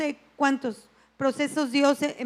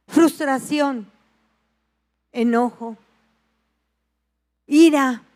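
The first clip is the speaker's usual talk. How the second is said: each word louder, ending loud on ira; building emphasis; 9 dB louder, slower than usual. Real, not acted. Preacher warning the church, building to a loud ira. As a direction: punch each word harder. Listing rising emotions.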